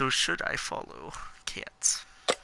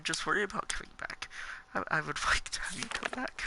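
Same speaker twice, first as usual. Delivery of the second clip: softer, whispered plea with a giggle; 5 semitones higher, 5 dB quieter than usual. Pretend. Begging Mary Jane to come back, soft giggly plea. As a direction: plead gently, half laughing.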